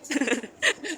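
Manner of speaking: high-pitched amused giggle; real giggle. Real, not acted. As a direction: break into a short, high laugh. Amused by her own "bumping.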